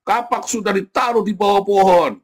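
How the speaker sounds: hammed-up prophet denouncing a man in church; mock prophet rebuke. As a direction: call out Gilbert like a stern prophet, then slow the last line. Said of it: "Anda kalau ngomong gitu sama Gilbert".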